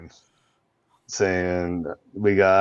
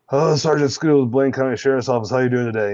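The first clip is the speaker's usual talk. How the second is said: higher, louder, bright traffic-stop opener; cheery cop greeting; 5 semitones higher, 4 dB louder than usual. Retold or imitated. Deputy greeting a speeder, higher and louder. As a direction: play the friendly deputy pulling someone over. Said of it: "I'm like".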